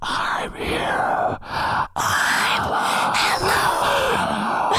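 eerie, drawn-out unvoiced whisper; creepy whispering. Co-host imitates the disembodied voice the X-Men hear, creepy whispering. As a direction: whisper it like a ghostly voice in someone's head. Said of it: "they hear this creepy whispering".

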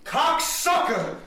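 high, loud, drawn-out outburst; Danny Aiello impression. Comic answering the list reader, shouted celebrity impression. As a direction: yell it slow and big. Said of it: "You got Danny Aiello".

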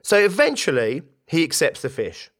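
normal talking voice

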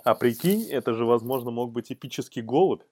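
normal talking voice

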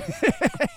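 very high giggling burst; laugh. Real, not acted. Host amused by a listener's answer, high laugh.

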